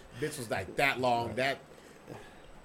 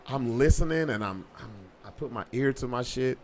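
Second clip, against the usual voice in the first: ordinary talk, nothing marked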